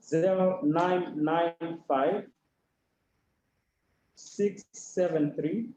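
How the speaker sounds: slow, spaced-out phone number; dictating a number for people to write down; slowly. Real, not acted. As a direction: read the digits out slowly with long pauses. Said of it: "I'll say it slowly".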